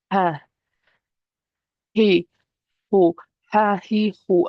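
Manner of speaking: airy ha, he, ho from the throat; breathy ha he ho. Shown as a demonstration. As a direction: breathe out each syllable like steam on glass. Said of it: "if you start doing the motion".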